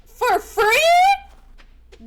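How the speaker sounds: loud, very high incredulous question; scandalized shout. Real, not acted. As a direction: shriek it in mock scandal. Shocked at cat on camera.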